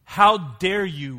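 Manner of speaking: a woman insulted by a crude offer, voiced with slow indignant outrage.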